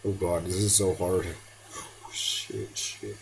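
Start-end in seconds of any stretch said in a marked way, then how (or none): 1.8-3.2 hushed panicked swearing; whispered curse. Real, not acted. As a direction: hiss it under your breath. Panic under fire.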